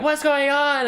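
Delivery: high, alarmed call across the camp; confused guard shout. Acted. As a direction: shout it mid-scramble.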